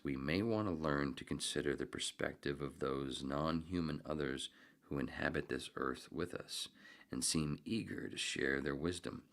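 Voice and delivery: measured, formal reading voice; formal reading aloud. Acted. Streamer reading an essay to chat, formal reading voice. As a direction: read it like a serious essay. Reads a book passage aloud.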